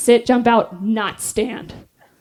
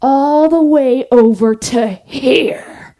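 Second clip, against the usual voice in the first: slow, drawn-out instructor orders; slower than usual. Retold or imitated. Instructor slowly explaining the task to the recruits. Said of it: "And she goes".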